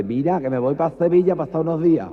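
tono alto y afectado; voz amanerada. Acted. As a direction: parodia amanerada alegre. (voz amanerada exagerada)

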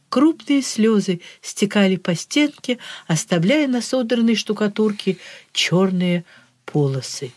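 ordinary delivery, nothing marked

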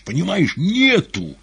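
high, loud desperate yell; terrible shout. A narrator's reading. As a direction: scream it out in panic. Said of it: "страшным голосом прокричал".

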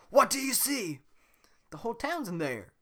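high, loud question; excited character voices. Acted. Shrek asking Donkey what he sees, high and eager. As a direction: call up to him eagerly.

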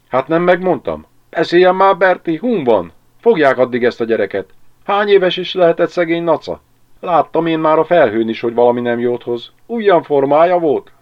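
loud, high-pitched village gossip in full agitation; excited jabbering. A narrator's reading. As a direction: press for news, all worked up. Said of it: "A levegőben izgalom terjengett". (izgatottan, hangosan sopánkodva)

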